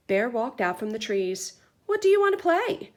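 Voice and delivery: put-on lively read-aloud for kids; story narration. A narrator's reading. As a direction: read to the class with energy. Teacher reads picture book aloud.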